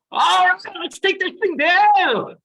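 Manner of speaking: high, loud, slow hammed-up cowboy drawl. Retelling the cowboy passenger, hammed-up high slow whoop. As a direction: imitate a gung-ho cowboy riding the plane down. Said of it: "a guy ... with a cowboy hat going".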